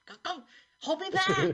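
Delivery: high squeaky voice, go hold me back; a fighter suddenly shrinking back; squeaky mock bravado. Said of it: "and he goes".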